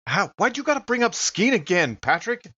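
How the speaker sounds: normal talking voice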